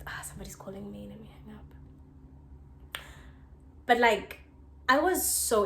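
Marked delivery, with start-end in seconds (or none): 0.1-3.0 whispered aside. Real, not acted. Aside about an incoming call, whispered. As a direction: murmur it off to the side.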